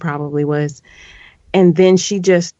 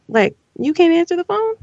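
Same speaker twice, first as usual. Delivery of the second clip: incredulous; 11 semitones higher than usual. Real, not acted. Incredulous outburst.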